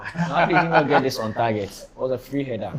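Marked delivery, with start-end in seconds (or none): 0.0-1.7 loud, raised-pitch incredulous question; laughing disbelief. Real, not acted. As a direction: protest the miss through laughter. Still astonished at the miss.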